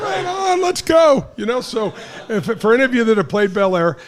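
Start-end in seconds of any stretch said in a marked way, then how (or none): 0.0-1.3 high, bright eager voice; excited high-pitched yes; eager excitement. Retold or imitated. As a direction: jump at the offer like a thrilled kid. Eager kid accepting beer.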